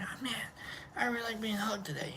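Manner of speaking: soft contented murmur; whisper. Retold or imitated. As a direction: hush it, savoring the hug. Acting out being hugged.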